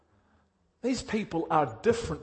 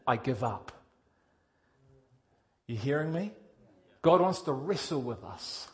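normal talking voice

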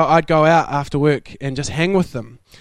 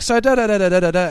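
quick mock chatter; fast babble; 2 semitones higher, much faster than usual. Caller rattling on unheard, fast mock babble. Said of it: "they're like".

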